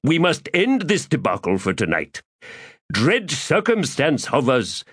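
florid, theatrical gentleman rogue; lofty, self-pleased orator; pompous Crupper voice. Acted. Crupper speaks.

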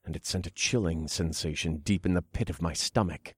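plain reading voice

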